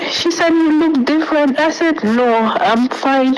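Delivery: tearful, voice pitched high and breaking; real tears while recounting the ordeal; crying. Real, not acted. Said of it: "I just have to cry".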